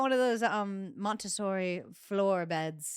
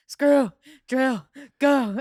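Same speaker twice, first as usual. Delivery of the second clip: commanding shout; 5 semitones higher, 9 dB louder, much slower than usual. Retold or imitated. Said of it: "And I was like".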